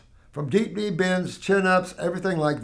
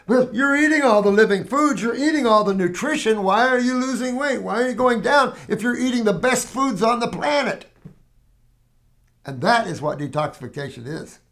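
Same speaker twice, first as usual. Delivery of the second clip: ordinary talk, nothing marked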